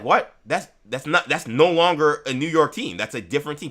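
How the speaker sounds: loud, high, indignant fan; outraged fan. Retold or imitated. Knicks fan protesting the team's move, outraged. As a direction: shout it like a betrayed Knicks fan. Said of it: "We'd be like".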